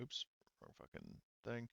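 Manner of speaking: ordinary talk, nothing marked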